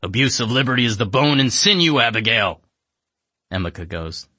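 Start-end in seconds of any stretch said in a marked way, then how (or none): 0.0-2.6 gruff monster voice, louder; grunting monster. Acted. Wajim grunts at the narrator and Emika. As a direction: grunt the words like a lab-made beast. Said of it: "Wajim looks up at us and grunts".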